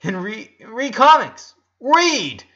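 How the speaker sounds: high, loud, hurried correction; flustered protest. A narrator's reading. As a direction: fluster and insist. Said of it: "face as red as Lynn's shorts".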